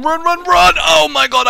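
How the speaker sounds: high, loud panicked yell; panicked shouting. Real, not acted. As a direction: shout to flee the attack. Fleeing boss attack.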